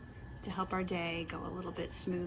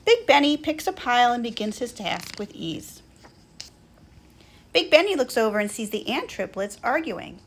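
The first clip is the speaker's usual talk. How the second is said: lively, louder storybook narration; children's story reading; 4 semitones higher, 11 dB louder than usual. A narrator's reading. Narrator reading Schubert's morning to children, lively storytelling. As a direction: read it out brightly for little listeners.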